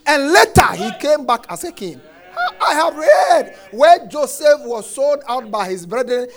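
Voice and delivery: loud, rising proclamation; triumphant preaching. Real, not acted. Preacher proclaiming his knowledge to the church, loudly. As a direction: declare it like a victory.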